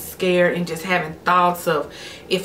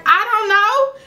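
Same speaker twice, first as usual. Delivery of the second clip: high, louder helpless answer; tearful reply; 5 dB louder than usual. Retold or imitated. Answering her boyfriend, high and helpless. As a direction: push it up high, near tears. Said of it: "And I was like".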